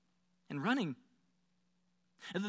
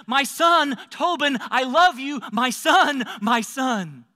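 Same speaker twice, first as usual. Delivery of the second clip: loud, raised, emotional cries; overjoyed father; 5 semitones higher, 6 dB louder, slower than usual. Acted. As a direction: pour out love loudly to a lost son. Father embraces returned son, repeating endearments.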